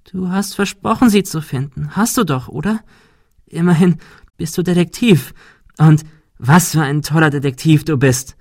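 hohe, höhnische Stimme eines Bockwesens; spöttische Kreaturenstimme. A narrator's reading. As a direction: neckend und überlegen, viel höher. Pan/Monika verspottet Ben. (Monika als Bock verspottet den Detektiv, hoch und höhnisch)

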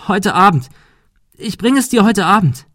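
high, loud frightened begging; pleading. A narrator's reading. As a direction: beg him desperately. Frightened girl begs attacker. (hohes, lautes Flehen)

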